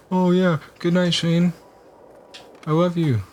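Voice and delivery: high-pitched imitation of an energetic girl; high, put-on girlish sweetness; super energetic girl. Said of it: "super energetic and junk".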